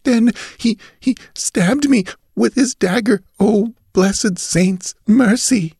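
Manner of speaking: put-on high, halting, frightened girl; dying gasps. A narrator's reading. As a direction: plead with your last breath. Dying girl recounts her stabbing.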